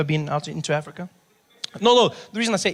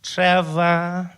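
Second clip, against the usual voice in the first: higher, louder, drawn-out echo; receptionist echoing; 4 semitones higher, 7 dB louder than usual. Retold or imitated. Receptionist repeats patient's name, loud drawn-out role voice. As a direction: repeat the name loudly and slowly. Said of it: "She's like".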